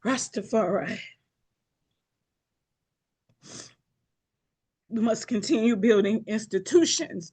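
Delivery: measured, declaiming speech to the council; stately address, pausing between each item in a list; formal keynote address. Acted. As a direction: proclaim each line like a keynote.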